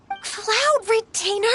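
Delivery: high drawn-out pleading; embarrassed protest. Acted. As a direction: beg the storyteller to stop, flustered. Ganyu protests embarrassing story.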